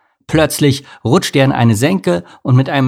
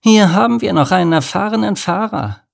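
hornet calling in a high voice; 9 semitones higher, 3 dB louder than usual. A narrator's reading. Hornet presenting a driver to the spider, calling out. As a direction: announce it high, without a full shout. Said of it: "rief die Hornisse".

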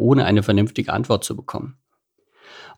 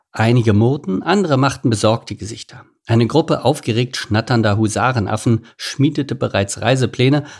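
ordinary delivery

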